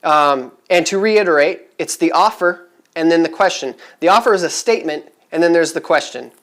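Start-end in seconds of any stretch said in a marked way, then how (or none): none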